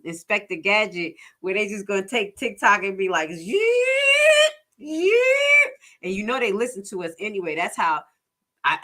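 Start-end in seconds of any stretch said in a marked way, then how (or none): 3.3-5.7 high squeaky yeet yeet; mock yeet. Mocking China grabbing TikTok, high silly yeet. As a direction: toss it away in a high cartoon cry.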